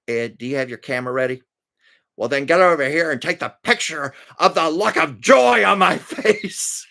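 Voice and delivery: mock-bitter demand for a photo; Eunice's sarcasm. Eunice ordering Ed to photograph her sarcastic joy. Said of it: "at one point".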